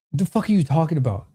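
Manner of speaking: ordinary talk, nothing marked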